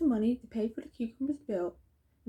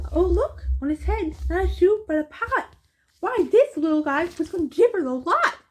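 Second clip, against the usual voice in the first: louder, higher, eager Oh look; excited discovery; 6 semitones higher, 7 dB louder than usual. Acted. A passer-by spotting the friend with a pot on his head, excited calling out.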